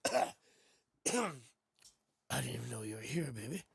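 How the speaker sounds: deep, smooth croon; sultry low voice. Pretend. Streamer croons low to viewers who were already there.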